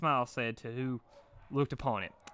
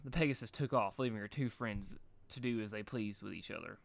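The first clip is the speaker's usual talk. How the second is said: ordinary delivery, nothing marked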